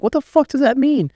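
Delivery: high-pitched, indignant question; retold hostile demand. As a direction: act out a defensive demand for explanation. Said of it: "I was very hostile over there".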